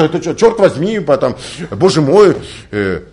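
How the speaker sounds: normal talking voice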